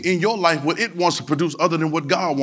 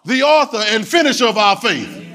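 louder, higher preaching cadence, hammering each phrase; preaching at full force; 7 semitones higher, 4 dB louder than usual. Acted. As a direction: drive it home like a pastor rising in the pulpit.

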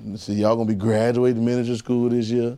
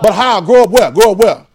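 higher, louder call; loud question; 8 semitones higher, 9 dB louder than usual. Acted. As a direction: call it out to the congregation. Preacher calls out question loudly.

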